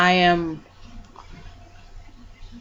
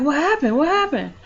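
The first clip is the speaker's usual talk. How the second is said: high, urgent alarmed question; 8 semitones higher than usual. Retold or imitated. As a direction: ask in a startled rush, fearing the worst. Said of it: "I'm like".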